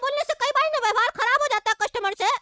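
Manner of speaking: high-pitched cartoon child voice; squeaky kid challenging a grown-up; high, indignant child; miffed little boy. Child customer, put out.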